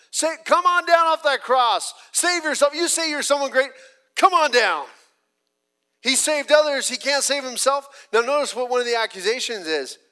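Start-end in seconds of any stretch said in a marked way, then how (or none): 0.1-4.9 loud jeering crowd, high and loud; mocking yell. Preacher voicing the crowd taunting Jesus, loud mocking yell. 6.0-8.1 loud, high jeering taunt; mocking taunt. Preacher voicing mockers jeering at Jesus, loud sneering taunt.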